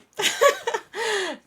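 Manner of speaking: loud, very high real laugh; sudden high-pitched giggle; burst of laughter. Real, not acted. Laughs after the sponsor message.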